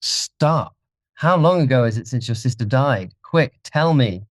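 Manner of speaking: high, pleading interruption; frantic hope, pitched well up; desperate urgency. Acted. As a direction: cry out to halt your executioner. Dorian's desperate last hope.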